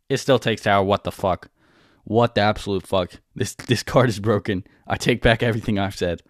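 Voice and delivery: usual speaking voice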